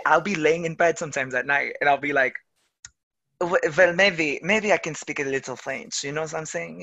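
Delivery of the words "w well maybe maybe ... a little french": playful mock French accent; thick French vowels, hesitant start. Lying in bed practicing dialects alone, put-on French accent. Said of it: "like, a French accent or a British accent".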